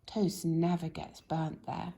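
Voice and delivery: ordinary delivery, nothing marked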